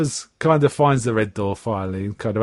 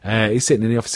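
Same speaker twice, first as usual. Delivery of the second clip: ordinary talk, nothing marked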